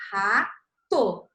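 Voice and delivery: "Rato" drawn out to match the letters; "Rato" lido devagar; sounded out slowly. Shown as a demonstration. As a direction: read "Rato" slowly as a child would. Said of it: "daquele jeito que a gente falou".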